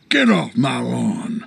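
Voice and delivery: low, slow grouchy growl; grumpy warning. Acted. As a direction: drag it out like a territorial old grouch. Old soldier chasing off young intruders.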